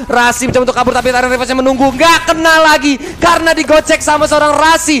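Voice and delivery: high, loud hype play-by-play; esports caster. Acted. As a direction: call the teamfight at full excitement. Excited play-by-play during teamfight.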